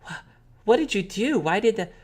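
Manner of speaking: put-on high, questioning kid voice; high-pitched, puzzled child; little sister. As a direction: press for answers like a child. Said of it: "she sighs a little bit and she says".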